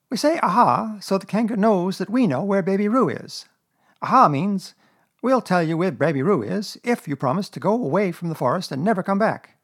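high, emphatic storybook schemer; Rabbit, bossy explainer. Acted. Rabbit explaining to Pooh what Aha means, insistent.

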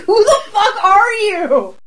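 high, drawn-out incredulous wail; mock disbelief. Aghast at her own new accent, high drawn-out disbelief. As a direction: wail it slowly in mock horror. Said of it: "And I'm like".

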